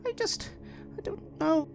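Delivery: put-on high, faltering child's voice; a girl close to tears, unsure; small girl near tears saying she doesn't know; upset little girl. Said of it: "and she's like".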